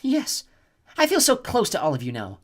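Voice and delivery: a cruel goddess savouring her plan; cold snow goddess. A narrator's reading. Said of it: "Chione said".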